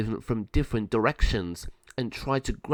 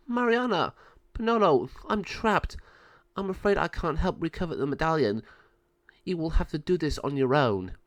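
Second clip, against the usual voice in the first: lightly voiced trapped sister; 6 semitones higher than usual. Trapped Sabio voice.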